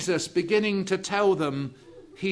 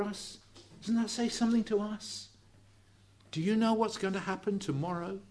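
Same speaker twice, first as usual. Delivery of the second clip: softer intimate appeal, low and close; hushed preaching; 3 semitones lower, 8 dB quieter than usual. Acted. Preacher urging listeners to listen now, softly earnest. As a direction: lean in and plead gently.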